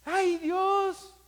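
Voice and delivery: high, loud wailing cry; hammed-up anguished sigh; mock wail to God. Mock prayer of the faithful.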